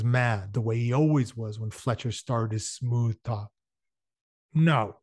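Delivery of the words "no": short, blunt, louder no; a clipped flat refusal; curt refusal. A narrator's reading. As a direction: snap the single word shut. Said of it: "he said shortly".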